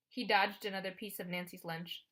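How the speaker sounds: ordinary delivery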